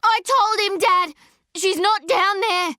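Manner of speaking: very high, loud desperate shout; girl shouting at her father across the grave. Acted. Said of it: "she shouts at her father".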